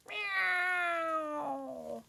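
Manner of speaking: high, drawn-out meow; cat meow. Pretend. Presenter voicing the toy cat for children, long high meow.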